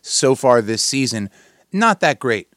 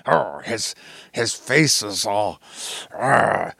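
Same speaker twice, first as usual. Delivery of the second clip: a lightly voiced cartoon buzz-cut guy, slower; Ren and Stimpy older man voice; 2 semitones lower, slower than usual. Host mimicking a cartoon coach, lightly voiced cartoon man. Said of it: "I don't think he talks like that".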